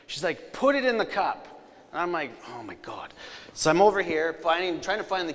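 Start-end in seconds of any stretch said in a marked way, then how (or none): 0.5-1.5 high, insistent order; insistent wife. Retold or imitated. Wife insists in retold argument. 2.5-3.1 low, soft groan of disbelief; exasperated aside. Retold or imitated. Exasperated husband aside in retold quarrel.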